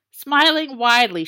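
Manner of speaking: plain reading voice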